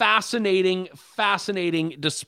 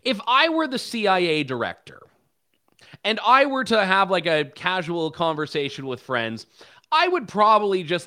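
normal talking voice

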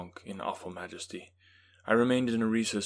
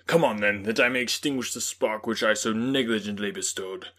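a loud, higher furious outburst; raging; 4 semitones higher, 9 dB louder than usual. A narrator's reading. Victor cursing the creature before springing at him, raging loud. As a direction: hurl curses at the fiend. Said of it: "My rage was without bounds".

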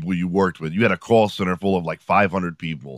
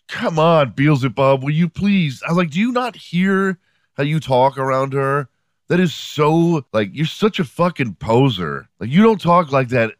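retold exasperation, pitched up; exasperated pleading; 6 semitones higher than usual. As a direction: plead with a poser to cut it out. Acted rebuke of his ex-wife.

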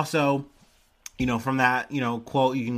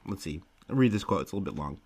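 usual speaking voice

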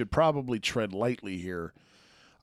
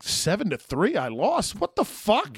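shocked disbelief; 9 semitones higher than usual. Retold or imitated. Retold shock at a loss.

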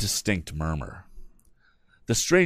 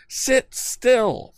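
panted; 3 dB louder than usual. A narrator's reading. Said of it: "panted our hero".

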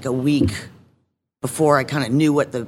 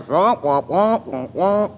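muted trombone wah wah; mock wah-wah babble; Charlie Brown adult noise; 3 semitones higher than usual. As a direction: imitate the droning grown-up voice from the cartoon. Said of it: "only hear, like, Charlie Brown".

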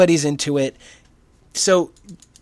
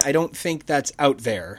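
ordinary talk, nothing marked

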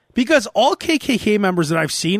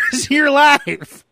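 ordinary talk

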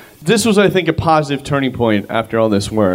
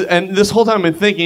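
ordinary talk, nothing marked

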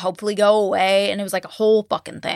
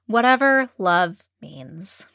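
slow dismissive quote; much slower than usual. Retold or imitated. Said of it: "He says".

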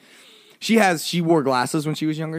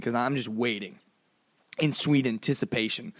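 ordinary delivery

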